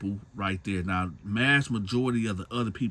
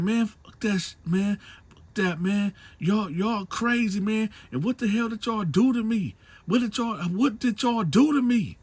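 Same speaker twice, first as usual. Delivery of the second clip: much higher, agitated swearing; panicked refusal; 12 semitones higher, 3 dB louder than usual. Retold or imitated. Man refuses the women, swearing high and agitated. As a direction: refuse in high, rattled outrage.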